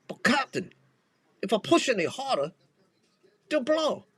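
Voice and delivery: a hammed-up Scotty warning the captain; Scottish accent. Host's second try at Scotty to the captain, high Scottish accent. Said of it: "Pretty shitty, Scotty".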